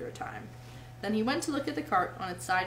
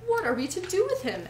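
worried; 9 semitones higher, 4 dB louder than usual. Acted. Worried Mole asks.

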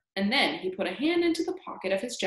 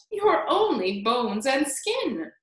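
higher, drawn-out retort; slow, high-pitched taunt; crying out; 5 semitones higher, 4 dB louder, slower than usual. A narrator's reading. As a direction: stretch out the insult as a haughty cry. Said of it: "Aunt Sponge cried out".

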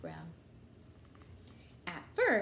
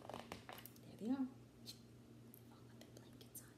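whispered aside; 14 dB quieter than usual. Acted. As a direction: hush so the sleeping cows stay asleep. Reader whispers about sleeping cows.

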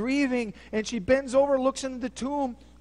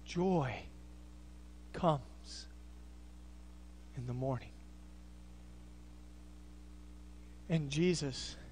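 much softer and lower, long pauses; hushed preaching; 6 semitones lower, 8 dB quieter than usual. Acted. Preacher slowing the congregation down, hushed and low. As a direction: pull the room into a hush. Hushed slow sermon passage.

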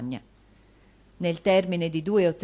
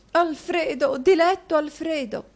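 anguished call; 10 semitones higher, 6 dB louder than usual. A narrator's reading. Wife runs calling her husband.

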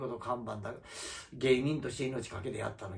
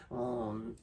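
ordinary delivery

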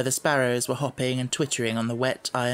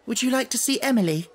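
put-on bright girl voice; 10 semitones higher, faster than usual. A narrator's reading. Sarah changes subject brightly.

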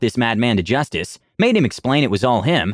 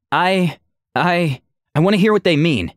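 higher, faltering stammer; hesitant stutter; 6 semitones higher than usual. Acted. The artificer explaining why she aims at Aura, stuttering. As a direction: stumble over the first words. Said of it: "she stuttered".

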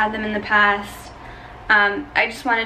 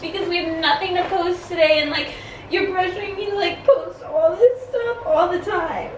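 high, whiny mock crying; pranking boyfriend with a staged breakdown, high mock sobbing; fake panic attack; 7 semitones higher than usual. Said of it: "then I'll start the panic attack".

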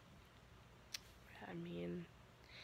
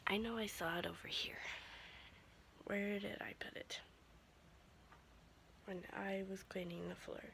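whispering; 6 dB quieter than usual. Real, not acted. People asleep, whispering.

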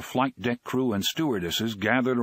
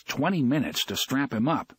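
usual speaking voice

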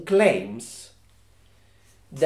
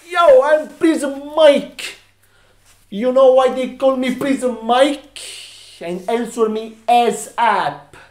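Prison Mike; 3 semitones higher than usual. Acted. A character introducing himself to the office, brash convict voice. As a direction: play a pushy ex-con throwing his weight around. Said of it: "I have someone, someone else, I would like you to meet".

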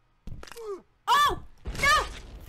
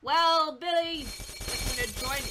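usual speaking voice

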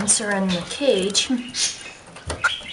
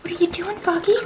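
pet talk; 8 semitones higher, faster than usual. Real, not acted. Cooing to pet bird.

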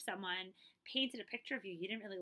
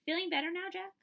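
1 high cheerful check-in; 9 semitones higher, 7 dB louder than usual. Acted. Checking on a friend.

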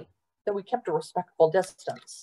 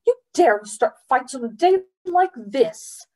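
higher, indignant rebuke with hard stresses; snapped scolding; 5 semitones higher, 4 dB louder than usual. A narrator's reading. Mother rebukes boy for fighting, snapping. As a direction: scold as if outraged by the nerve. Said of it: "snapped Missus Sivapan".

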